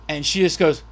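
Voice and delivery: ordinary delivery, nothing marked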